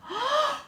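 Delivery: high, sharp theatrical gasp; sudden stagey intake of breath; shocked gasp. Acted. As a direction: gasp in horror at a loss. Eva finds the tiara missing.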